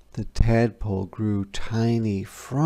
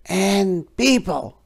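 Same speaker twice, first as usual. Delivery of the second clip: frog; 9 semitones higher, 5 dB louder than usual. A narrator's reading. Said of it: "said the frog".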